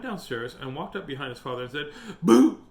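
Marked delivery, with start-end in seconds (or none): 2.2-2.6 loud, high sudden boo; scaring boo. A narrator's reading. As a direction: burst out loudly to make someone jump. Boy scaring father in story.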